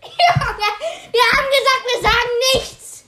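high, loud, laughing protest; laughing exclamation. Real, not acted. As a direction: burst out laughing, exasperated. Laughing outburst after blown prank.

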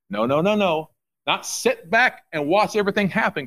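ordinary talk

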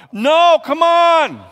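a preacher's loud pleading protest; emphatic protest. Real, not acted. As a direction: cry out no, come on. Preacher rejecting it loudly.

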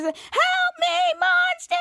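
high, drawn-out mock scream for help; retold scream. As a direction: sing out the cry, stretching the last word.

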